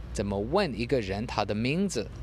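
usual speaking voice

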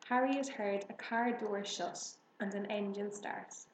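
ordinary delivery

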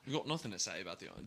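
quick Australian twang; Australian accent. Pretend. Cohost answering in an Australian accent. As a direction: rattle it off like an Aussie. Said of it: "You guys fucking nailed it".

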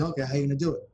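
a light mock of a friend's excuses; acting out both sides. Acting out excuse-making conversation.

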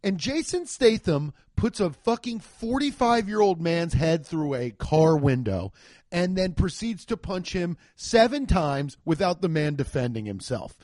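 normal talking voice

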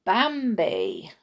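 usual speaking voice

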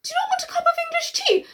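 very high, quick English tea offer; mock English host. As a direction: chirp it up high and fast.

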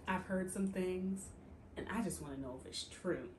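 the queen quietly asking the knight about rumors; hushed questioning. Retold or imitated. Alicent questioning, voiced softly.

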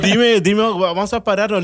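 alarmed friend demanding answers; urgent friend. Retold or imitated.

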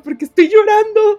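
high, loud panicky question; frightened retold cry. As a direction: relive the scare of crying for no reason. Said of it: "me asusté muchísimo".

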